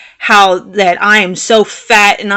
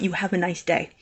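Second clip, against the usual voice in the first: restrained; 2 semitones lower, 10 dB quieter than usual. Retold or imitated. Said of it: "I did not raise my voice".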